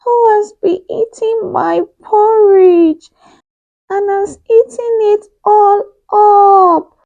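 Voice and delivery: very high, loud little bear voice, slow; tiny little voice. Acted. Baby Bear finding his empty bowl, tiny high voice. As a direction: squeak it out like a wee baby bear. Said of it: "said in a tiny little voice".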